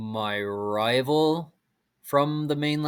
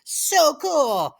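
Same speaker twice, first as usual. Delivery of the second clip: excited fangirl squeal; 14 semitones higher, 4 dB louder than usual. Retold or imitated. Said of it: "I was like".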